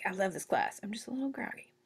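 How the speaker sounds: groggy student. Retold or imitated. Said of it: "You're like".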